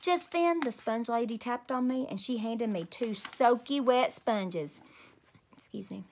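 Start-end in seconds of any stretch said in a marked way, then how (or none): 5.5-6.0 whispered aside. Real, not acted. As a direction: excuse yourself in a hush. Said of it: "whispered aside".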